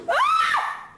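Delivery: sudden high shriek; startled shriek. Real, not acted. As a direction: shriek at the bird. Bird flies at her.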